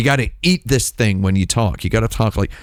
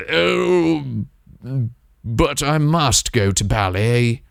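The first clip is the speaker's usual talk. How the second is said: dreamy Mimsy; 2 semitones higher, slower than usual. Retold or imitated. Mocking how Mimsy talks off stream, dreamy and slow. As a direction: swoon over every word like a tragic artist. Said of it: "That's what Mimsy s-, talks like".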